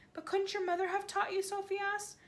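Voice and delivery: put-on high, questioning girl; little girl voice. A narrator's reading. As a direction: ask as a curious girl. Sophie's voice.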